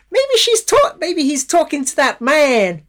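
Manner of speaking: put-on very high, slow, wavering little old lady. Quoting the little old lady from the film, in her high voice. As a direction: play a sweet, fussy old lady. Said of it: "you get the little old lady go".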